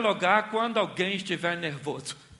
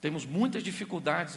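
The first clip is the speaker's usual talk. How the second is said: usual speaking voice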